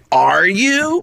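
high, rising call to the troops; rousing challenge. Retold or imitated. Imitating the teacher rallying the cast, high and rousing. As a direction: ask it like a coach firing up a team. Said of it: "I thought he was like".

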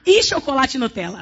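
ordinary talk, nothing marked

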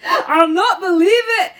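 high, loud Scottish protest; hammed-up indignation in a Scottish accent; dramatic Scottish inspector. Inspector refusing to believe Poirot, dramatic and indignant.

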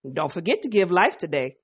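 normal talking voice

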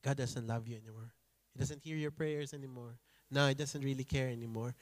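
low, soft, insinuating voice; whispered lie. Retold or imitated. The enemy's lie slipped into your mind, soft and low. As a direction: murmur it like a tempter in the ear. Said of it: "the number one lie is".

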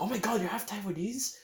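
high, bright thrilled gasp; excited. Retold or imitated. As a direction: gush like a delighted auntie. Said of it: "the eyes literally light up like".

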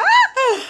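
high mock wail, laughing; mock shocked cry. Storyteller mimics the girl she hit, mock shocked cry. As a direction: imitate a stunned girl's high "Ah!". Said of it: "didn't know whether to cry or holler".

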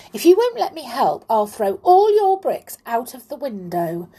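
put-on high, loud childish threat; angry threat. A narrator's reading. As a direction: threaten in a temper, starting fast. Amelia angry, threatens the clown.

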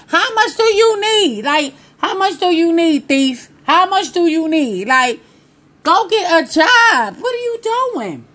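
high, exasperated telling-off; mock scolding. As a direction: lecture an absent thief in comic disbelief.

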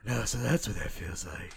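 lightly put-on breathy Batman; mild mock menace. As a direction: say it like a winded Batman.

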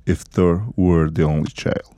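lightly sultry narrator voice, slow; sexy voice. Shown as a demonstration. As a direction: say the title slow and seductive. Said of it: "otra vez, con voz sexy".

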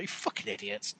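whispered under the breath; muttered insult. Retold or imitated. Shouting at quiz show contestants, whispered insult. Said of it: "It's like".

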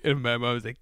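ordinary delivery

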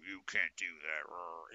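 stern parent. Retold or imitated. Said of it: "it was never a serious".

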